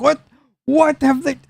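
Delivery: high, baffled voice; a startled clerk blurting out; confused NPC. Acted.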